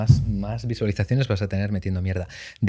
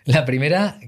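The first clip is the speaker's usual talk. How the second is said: usual speaking voice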